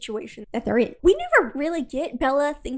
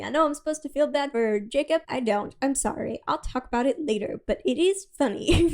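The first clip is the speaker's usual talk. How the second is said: ordinary talk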